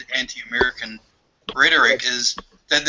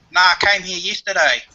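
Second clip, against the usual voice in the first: higher-pitched put-on New Zealander; New Zealander in a joke; 6 semitones higher, 4 dB louder than usual. New Zealander answers the Australian's shout, put-on Kiwi voice. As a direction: deliver the punchline as a New Zealand soldier, pitched up. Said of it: "And the New Zealander says".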